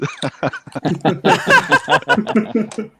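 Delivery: high, loud extended laughter; a big hearty laugh; long loud laugh. Real, not acted. Hyping the party.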